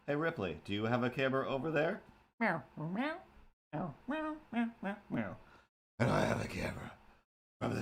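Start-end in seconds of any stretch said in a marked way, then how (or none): 2.4-5.4 high squeaky cartoon cat meows; meowing cat. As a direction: meow like a little cartoon cat.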